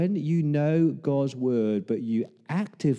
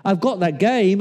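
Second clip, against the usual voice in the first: high, loud, eager son bursting in; raised-voice excited announcement; storming in; 8 semitones higher, 5 dB louder than usual. Acted. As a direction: call it out as you charge in. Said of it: "Esau comes storming in and says".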